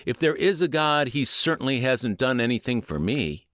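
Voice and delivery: hospital patient bitterly rejecting a visitor's talk of God; bitter outcry. A narrator's reading. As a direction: spit it out in bitter defiance at the one offering faith. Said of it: "bitterly cried out".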